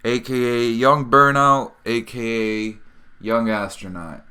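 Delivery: loud, drawn-out show-opening announcement; podcast host intro. Acted. Host welcomes listeners back to the podcast, big announcer intro.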